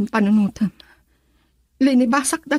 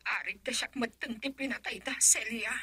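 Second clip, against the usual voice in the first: hushed; 3 semitones lower, 15 dB quieter than usual. Acted. Fearful hushed confession.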